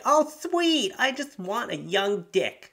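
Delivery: hammed-up cougar voice; cougar mom. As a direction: coo like an eager mom. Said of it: "she was like".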